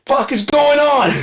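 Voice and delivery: high, loud, laughing disbelief; laughing shout. Retold or imitated. To the guy in a Batman costume, a loud laughing shout. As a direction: yell it slowly through laughter.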